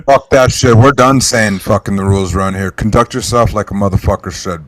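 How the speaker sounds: loud, forceful rant. Real, not acted. Laying down the rules for the boys, loud and forceful. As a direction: lay down the law at full volume. Ranting about conduct rules.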